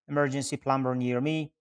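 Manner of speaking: usual speaking voice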